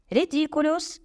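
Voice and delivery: high, restrained roar. A narrator's reading. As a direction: bellow the spell, only moderately loud. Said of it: "заревів Рон".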